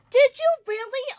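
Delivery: high, loud delighted question; excited. Real, not acted. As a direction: pop up with glee. Excited reply to viewer.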